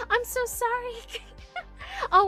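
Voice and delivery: ordinary delivery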